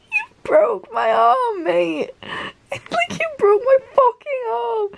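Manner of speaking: words wobble through giggles; laugh-crying quote. Real, not acted. Reader quoting Genya's complaint, high laugh-crying.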